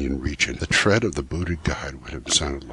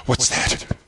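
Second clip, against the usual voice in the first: startled whisper; 8 semitones higher than usual. Acted. Startled by a sound in cave.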